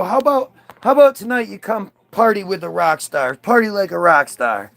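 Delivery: hammed-up music-industry hype man voice; slick exec pitch. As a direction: oversell the party like a smooth-talking label boss. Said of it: "they're like".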